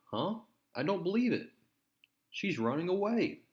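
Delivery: a reader's mock shock at the girl running off; comic-book hero caught off guard, reading it with a high surprised lift. Hero sees the girl flee.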